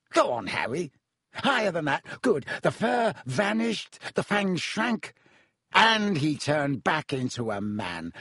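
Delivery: high, theatrical boastful showman; Lockhart voice. Acted. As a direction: ham up the heroic story.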